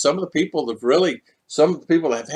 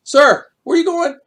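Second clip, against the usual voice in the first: higher, lively voice of a local asking where you're going; imitating friendly locals; 13 semitones higher, 3 dB louder than usual. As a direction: mimic a checkpoint local greeting a passing foreigner, pitched up. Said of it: "They'll always say".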